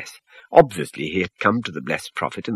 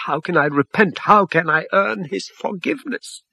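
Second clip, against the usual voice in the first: higher, pleading and desperate; anguished cry; 10 semitones higher than usual. A narrator's reading. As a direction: plead for a way back. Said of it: "he cried".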